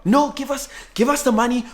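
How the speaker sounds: demanding family. Retold or imitated. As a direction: insist on the money first. Family demands money, voiced.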